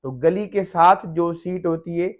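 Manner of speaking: normal talking voice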